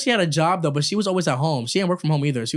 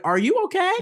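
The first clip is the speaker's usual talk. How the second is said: neighbor voiced. Neighbor quoted.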